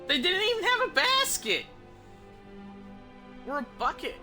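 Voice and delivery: squeaky, indignant protest; mock outrage. Real, not acted. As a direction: exclaim it high, as if appalled by the oversight. Mock outrage at in-game execution.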